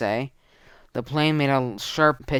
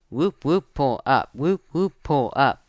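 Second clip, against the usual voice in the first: cockpit alarm voice; 3 semitones higher, 4 dB louder than usual. Acted. Cockpit ground proximity alarm.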